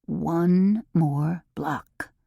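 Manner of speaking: slow, strained plea through the teeth; through clenched teeth. A narrator's reading. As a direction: beg with teeth clenched, drawn out. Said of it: "begged him through clenched teeth".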